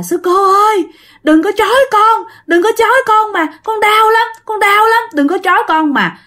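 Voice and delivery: put-on high, wailing five-year-old pleading; small child's voice. Possessed woman begging the nun not to tie her, wailing child voice. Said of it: "giọng giống như là một đứa con nít".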